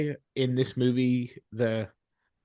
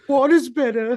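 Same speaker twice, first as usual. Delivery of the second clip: Water Boy impression; 9 semitones higher than usual. Co-host quoting the Water Boy, high mock voice. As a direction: ham up the Water Boy line. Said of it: "break out a water boy line".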